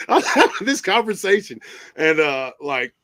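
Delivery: normal talking voice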